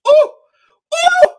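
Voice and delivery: hammed-up high pleasure moan, loud; high mock moan. Mimicking wife enjoying a shoulder rub, hammed-up moan. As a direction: ooh it out like she loves the rub.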